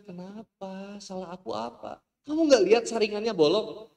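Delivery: wife shoots back in a high voice; defensive wife. Acted. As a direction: push the blame back at him. Wife's retort in quarrel.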